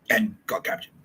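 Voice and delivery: ordinary talk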